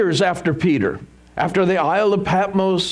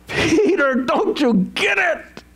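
high, drawn-out exasperated plea with a laugh; 11 semitones higher, slower than usual. Acted. Preacher to dense Peter who still doesn't get it, exasperated. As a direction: throw up your hands at him, high and slow.

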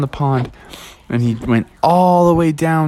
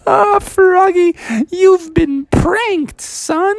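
very high, slow, drawn-out gloating; gleeful teasing mom; 4 dB louder, much slower than usual. Acted. Mom reveals the prank to Froggy, gleeful crowing. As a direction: crow it in a high cartoon mom voice. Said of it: "Froggy's mom was laughing so hard".

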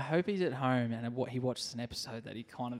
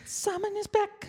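high falsetto echo; announcer voice; 3 dB louder than usual. Pretend. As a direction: sing it back in a high voice. Echoing in high voice.

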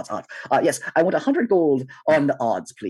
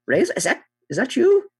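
high voice climbing even higher; Buttons, surprised; 13 semitones higher than usual. Acted. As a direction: squeak up in surprised recognition. Buttons recognises Razor.

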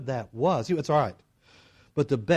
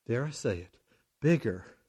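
ordinary talk, nothing marked

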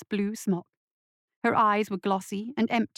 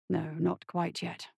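low, slow, hushed tone; quiet reassurance; 4 semitones lower, 7 dB quieter, slower than usual. Acted. Doctor answering his pleading patient, softly. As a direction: soothe softly and unhurriedly.

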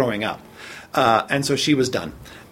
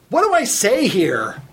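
clueless trainee asking; 6 semitones higher, slower than usual. Retold or imitated. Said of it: "and says, so everybody can hear it".

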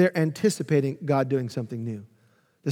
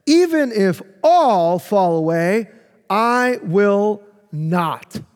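bold vow; 3 semitones higher, 5 dB louder, much slower than usual. Retold or imitated. Voicing Peter's bold vow.

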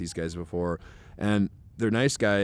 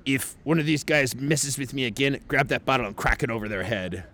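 slightly raised put-on Russian accent; annoyed Russian threat; 3 semitones higher than usual. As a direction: threaten as an irritated Russian, imitated. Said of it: "he's getting annoyed".

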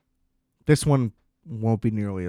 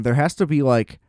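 usual speaking voice

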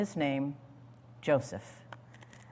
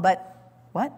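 usual speaking voice

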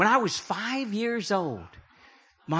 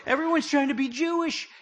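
higher mock reproach; Apostle Paul scolding; 7 semitones higher than usual. Paul warning believers, higher mock reproach. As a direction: voice Paul chiding them lightly. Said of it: "He said".

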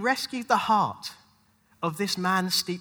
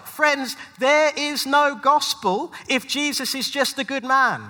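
louder and higher, driving each line home; preaching at full force; 6 semitones higher, 5 dB louder than usual. Acted. Preacher urging the congregation, at full force. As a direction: build the sermon to its peak.

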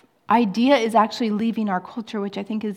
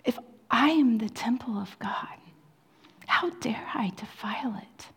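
hushed, intense sermon whisper; whispered preaching; 4 dB quieter than usual. Acted. A preacher whispering to his congregation about holiness. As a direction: draw them in with a whisper.

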